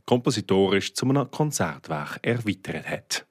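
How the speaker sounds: presenter's scripted closing announcement; radio sign-off. Real, not acted. Host tells listeners about concerts and podcast, radio sign-off. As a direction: read out the show's outro with concert details.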